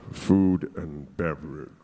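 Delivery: very low, slow mock British voice; fake British accent. Nervous applicant answering his hotel interviewer, affected deep British accent. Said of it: "lowering my voice and, you know, speaking with this m-, mild British accent".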